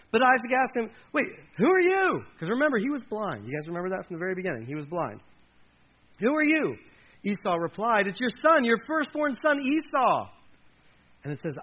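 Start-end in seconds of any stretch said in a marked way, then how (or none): 1.1-2.2 high, loud cry of alarm; startled question. Acted. Blind father to the son he can't see, startled. 6.2-6.8 loud, high-pitched challenge; startled question. Acted. Blind father challenging the newcomer, startled. Blind Isaac asks who is there. 8.1-10.3 high, loud, acted-out insistence; insistent reply. Firstborn son identifying himself to blind father, insistent.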